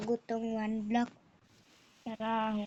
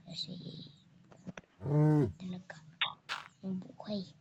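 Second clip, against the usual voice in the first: whispering; 2 semitones lower, faster than usual. Real, not acted. Whispers while planting.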